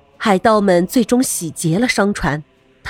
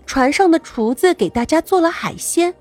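ordinary delivery, nothing marked

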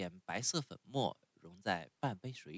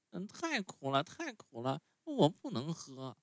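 put-on much higher, whiny little boy; puppet boy voice; 8 semitones higher than usual. A narrator's reading. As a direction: sulk like a spoiled child refusing medicine. Pinocchio voiced in story.

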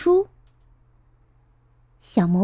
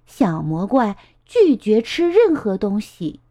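ordinary delivery, nothing marked

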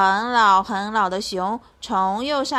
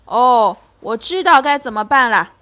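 put-on old bear, pleased; 2 semitones higher, 9 dB louder than usual. Bear has an idea.

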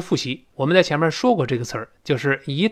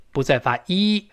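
ordinary talk, nothing marked

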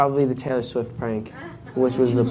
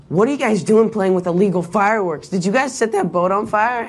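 higher, accusing quote; mildly indignant neighbor; 6 semitones higher than usual. Retold or imitated. Guest retells the prank neighbor confronting them, mildly indignant. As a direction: retell the neighbor's telling-off, pitched up.

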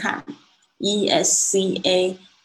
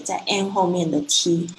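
ordinary talk

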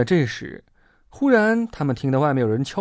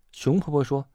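usual speaking voice

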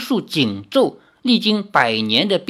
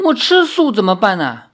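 pained whine; 5 semitones higher, 3 dB louder than usual. Acted. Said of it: "一只妖苦着脸说".

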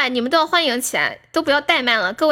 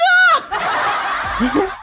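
high excited laugh; 11 semitones higher than usual. Real, not acted. After big gift.

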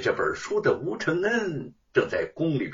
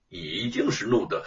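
usual speaking voice

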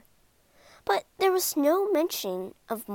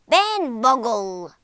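loud, slow teacher's bark; teacher barking the pupil's name; stern bark; 8 dB louder, slower than usual. Acted. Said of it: "barked Mister Grizzle".

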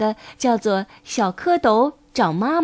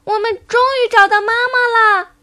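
put-on high, loud childlike cheer; overjoyed tadpoles; 11 semitones higher, 6 dB louder than usual. A narrator's reading. Tadpoles turning somersaults for mum, high jubilant cry. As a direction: burst out with joy as tiny tadpoles. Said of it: "高兴地在水里翻起跟斗来".

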